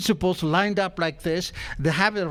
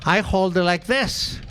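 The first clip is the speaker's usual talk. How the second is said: usual speaking voice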